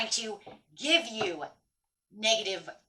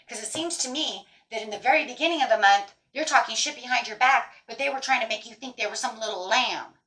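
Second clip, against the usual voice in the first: ordinary talk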